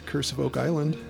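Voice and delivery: ordinary talk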